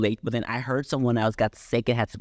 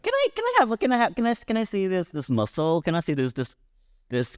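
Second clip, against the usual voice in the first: squeaky high imitation asking to see the muscle; high-pitched mock voice; 6 semitones higher than usual. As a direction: mimic a fawning fan in a high voice. Mocking friend asking to see muscle.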